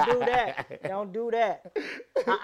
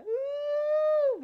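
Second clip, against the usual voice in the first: high drawn-out "ooh"; imitated "ooh"; 5 dB quieter than usual. Imitating the man shooting up, high held moan. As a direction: mimic a long, high ooh. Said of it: "He was like".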